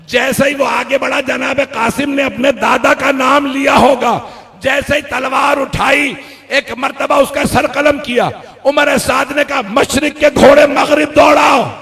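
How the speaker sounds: loud, high battle narration shouted; preaching at full force. Acted. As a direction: drive the battle scene at the crowd.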